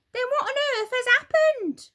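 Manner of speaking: put-on high, loud, upset old wife; Mrs Twit crying out. Old wife cries out to her husband, high and alarmed. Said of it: "cried Missus Twit".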